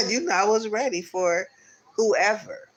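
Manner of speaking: laughing through the words; high, loud, giggly telling; amused. Real, not acted. Laughing at own story.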